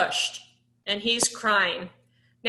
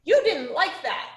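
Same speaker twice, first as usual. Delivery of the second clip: mimicking a child copying; 6 semitones higher than usual. Shown as a demonstration. Teacher showing how Sam would repeat it, higher and childlike. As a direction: parrot it like a little kid would. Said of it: "he'd be saying".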